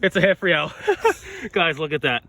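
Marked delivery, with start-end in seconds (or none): 0.8-1.5 high laugh. Real, not acted. Reacting to friend's coin.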